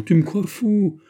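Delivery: high, loud, exultant tirade; excited exclamation. A narrator's reading. D'Artagnan proving to Planchet he is sane, excited exclamation. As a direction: cry it out with soaring joy. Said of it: "s'écria D'Artagnan".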